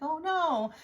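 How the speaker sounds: high dismayed oh no. Retold or imitated. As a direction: jump up high on oh no. Retold dismay at lost bag.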